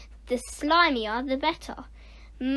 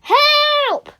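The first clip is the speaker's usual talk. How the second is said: very high, loud wail for help; long, high cry of alarm; 14 semitones higher, 9 dB louder than usual. Acted. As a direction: wail in panic. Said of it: "wailed Nick".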